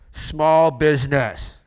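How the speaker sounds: very loud, drawn-out announcement; retold loud blurt. New banker answering at a noisy party, loud drawn-out blurt. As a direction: blare it out slowly over a party.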